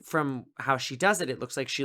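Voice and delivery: ordinary talk, nothing marked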